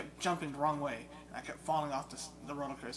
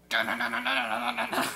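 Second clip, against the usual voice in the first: higher, louder frantic na-na chant; mock button-mash chant; 9 semitones higher, 9 dB louder than usual. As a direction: hammer out the nonsense syllables. Mimicking frantic button mashing.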